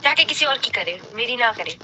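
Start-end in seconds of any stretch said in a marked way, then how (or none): none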